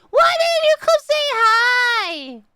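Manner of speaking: very high, drawn-out wail of complaint; mock-hurt outcry. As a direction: wail it in exaggerated indignation, stretched out.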